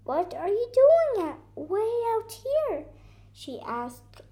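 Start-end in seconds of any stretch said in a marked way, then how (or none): 0.0-2.9 high, loud storybook question; raised pitch, amazed asking; surprised question. A narrator's reading. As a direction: ask the little one, astonished. Coco questions the lost deer.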